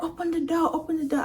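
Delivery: high-pitched mimicked urging; urgent bystander. Woman at the car window to the trapped driver, urgent. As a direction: imitate someone urging in a high voice.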